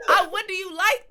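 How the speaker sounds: loud laughing question. Real, not acted. Host laughs at the guest who never asked about toppings. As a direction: ask it through real laughter.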